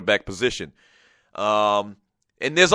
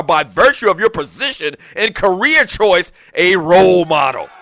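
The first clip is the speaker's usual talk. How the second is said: drill sergeant voice; 6 semitones higher, 6 dB louder than usual. Retold or imitated. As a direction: read it like a barking drill sergeant. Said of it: "I don't know why I'm talking like a drill sergeant".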